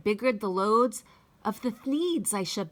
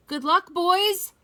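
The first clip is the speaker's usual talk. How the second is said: higher, louder farewell call; cried out; 9 semitones higher, 6 dB louder than usual. Acted. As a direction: call it out as they leave. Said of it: "he cried".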